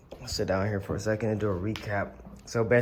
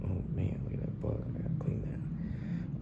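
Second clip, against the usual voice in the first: whispering; 10 dB quieter than usual. Real, not acted. Spots a bug, mutters aside.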